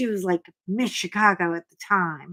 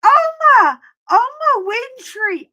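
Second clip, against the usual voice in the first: high, loud fan scream across the mall; excited shriek to a celebrity; screaming her name; 14 semitones higher, 9 dB louder than usual. Retold or imitated. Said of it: "I'm screaming it so loud".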